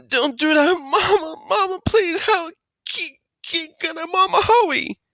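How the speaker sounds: high, muffled crying plea; frantic wailing. A narrator's reading. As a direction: wail for mom with lips stuck. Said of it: "Bi went nuts again".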